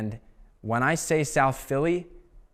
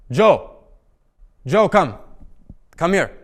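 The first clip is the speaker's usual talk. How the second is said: loud, high call across the room; an old man hollering for the waiter; 6 semitones higher, 9 dB louder, slower than usual. Retold or imitated. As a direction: shout for Joe to come over. [name] calling dad across restaurant.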